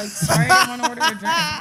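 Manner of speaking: loud, high-pitched fake apology; hammed-up indignant sorry; sarcastic mock apology. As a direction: apologize loudly with zero remorse. Sarcastic mock apology to absent bartender.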